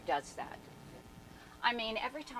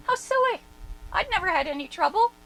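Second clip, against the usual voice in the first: amused disbelief; 11 semitones higher, 9 dB louder, faster than usual. Retold or imitated. Thinking back on her own worry.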